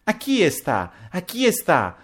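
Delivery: high, drawn-out cries of delight; excited girlish squeal; gleeful cry. A narrator's reading. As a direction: call out high and slow, tickled. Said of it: "si mise a gridare subito Pepita ridendo".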